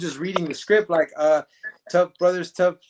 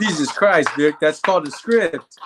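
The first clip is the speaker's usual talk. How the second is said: laughing exasperation; 5 dB louder than usual. Retold or imitated. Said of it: "And he goes".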